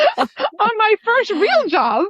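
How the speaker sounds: high, drawn-out words through laughter; laughing. Real, not acted. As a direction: crack up while telling it. Laughing over mother at interview.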